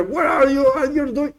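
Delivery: high, pointed, finger-wagging demand; accusing where are you; accusing question. Acted. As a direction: jab the question at him like a finger. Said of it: "like a question with a finger".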